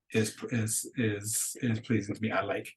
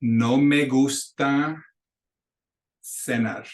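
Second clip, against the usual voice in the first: "No me gusta" slow and louder, then "cenar"; careful model sentence; 3 semitones higher, 3 dB louder, slower than usual. Shown as a demonstration. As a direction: say it slowly for the class to copy. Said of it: "So you can say, for example".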